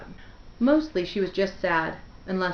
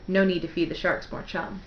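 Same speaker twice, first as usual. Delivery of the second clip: ordinary delivery, nothing marked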